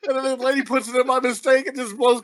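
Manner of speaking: high-pitched, giggling through every word; can barely get the story out for laughing; laughing while telling. Real, not acted. Co-host retelling the snow machine scene, laughing throughout.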